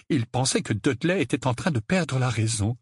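plain reading voice

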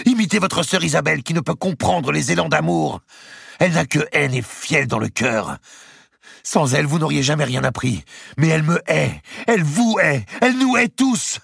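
high, loud tirade rising to a scream; fury. A narrator's reading. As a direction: rage bitterly, climbing phrase by phrase. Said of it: "un sursaut de fureur".

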